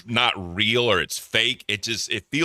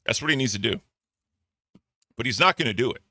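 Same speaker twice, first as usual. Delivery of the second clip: ordinary delivery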